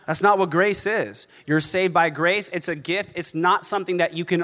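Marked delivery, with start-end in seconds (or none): none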